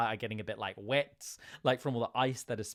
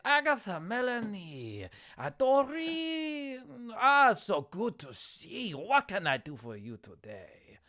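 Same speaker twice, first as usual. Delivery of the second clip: Etienne Beauregard; 10 semitones higher than usual. Acted. Fishmonger greeting his esteemed customers, effusive showman. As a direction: sing out each name, accented. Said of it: "And he says".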